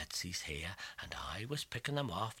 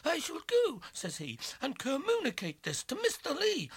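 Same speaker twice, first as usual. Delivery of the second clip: outraged lawyer voice; 7 dB louder than usual. Acted. Lawyer offended.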